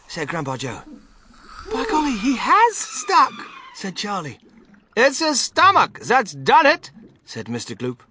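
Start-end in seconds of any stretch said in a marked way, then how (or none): 1.7-3.3 put-on high, loud boy's astonishment; raised, high-pitched surprise; amazed exclamation. A narrator's reading. Boy exclaims as Augustus sticks. 5.0-6.9 slow, high, loud character voice; raised, exasperated father; flustered father. A narrator's reading. Mister Gloop's voice in story.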